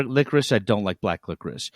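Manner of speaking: ordinary talk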